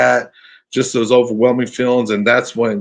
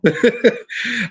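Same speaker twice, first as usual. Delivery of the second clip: real laugh; 11 semitones higher than usual. Real, not acted. Laughing at his scared friend.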